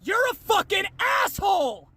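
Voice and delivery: real angry shouted insult; angry yell. Real, not acted. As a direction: hurl the insult at full voice. Insulting the commenter.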